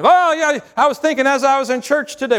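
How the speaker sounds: overfriendly caller laying it on thick; gushing caller. Retold or imitated. Caller voice again.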